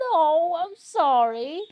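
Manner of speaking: drawn-out, high, loud mock wail; hammed-up tearful apology. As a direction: overplay the crying novice's sorry. Tearful apology, game dialogue.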